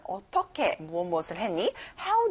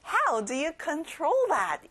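slow, careful teacher model; slow model sentence; 5 semitones higher, much slower than usual. Shown as a demonstration. Teacher models the question slowly for kids. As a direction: spell it out slowly for learners. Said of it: "이렇게 물어보면 돼요".

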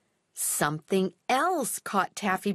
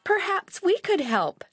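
put-on child voice; 8 semitones higher than usual. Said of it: "said Millie".

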